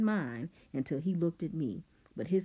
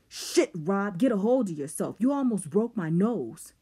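loud angry complaint; 5 semitones higher, 7 dB louder than usual. A narrator's reading. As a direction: yell it, nose stinging. Coop hit in face.

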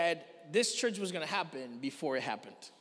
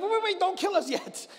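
pleading Paul; 11 semitones higher, 5 dB louder than usual. Retold or imitated. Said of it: "And Paul's like".

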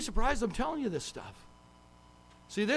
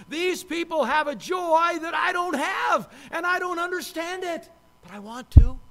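acted bewildered longing; 8 semitones higher, 8 dB louder than usual. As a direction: voice someone baffled by others' joy. Voices the wondering waitress's thoughts.

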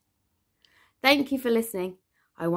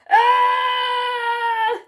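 hammed-up storybook scream; long, high shriek of fright; high, loud scream; 12 semitones higher, 8 dB louder than usual. A narrator's reading. Fisherman screams at the shark's smile. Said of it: "screamed the fisherman".

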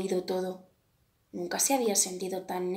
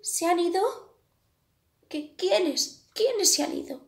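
much higher, louder small bird voice; the small bird checks whether the bullies are gone, higher and louder; little bird asking; 9 semitones higher, 5 dB louder than usual. A narrator's reading. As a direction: voice the tiny anxious bird. Small bird asks crow.

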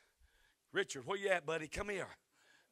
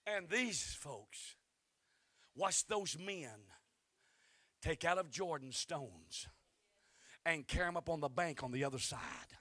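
slow storytelling; slower than usual. Acted.

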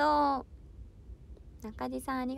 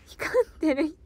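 laughing; 4 semitones higher, 8 dB louder than usual. Real, not acted. Laughing at a fan's name.

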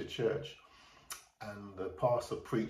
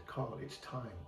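reassuring; 3 semitones lower, 10 dB quieter than usual. Retold or imitated. Uncle reassures scared nephew.

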